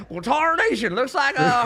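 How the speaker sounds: put-on high, drawling old-timer western voice; old prospector. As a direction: play a crusty old gold prospector. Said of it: "even turn on an accent".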